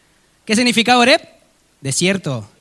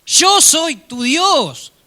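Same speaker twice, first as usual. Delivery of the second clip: slow, higher and louder divine proclamation; God declaring himself slowly, high and loud; booming God; 10 semitones higher, 4 dB louder, much slower than usual. Acted. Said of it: "Otra vez la misma conversación".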